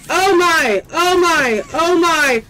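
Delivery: rising panicked cries; loud frightened repeated gasps; frantic oh my. Real, not acted. Panicking as enemies close in.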